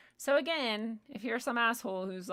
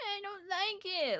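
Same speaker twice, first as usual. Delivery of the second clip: much higher, slow whiny put-on voice; mocking whiner; 10 semitones higher, slower than usual. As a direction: parody a petty complainer. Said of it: "if you're just gonna be like".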